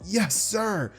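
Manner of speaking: high, startled "yes sir" from a frightened class; scared shout. Acted. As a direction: snap to attention in fright. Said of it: "would just let out".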